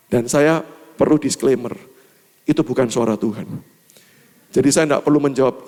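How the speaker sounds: ordinary talk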